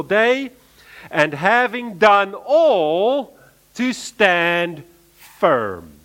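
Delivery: slow, rising, emphatic verse, each phrase lifted; emphatic scripture reading. Acted. Preacher to his congregation, reading the verse with slow emphasis. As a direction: read it out to the church as a solemn command. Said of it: "states it emphatically".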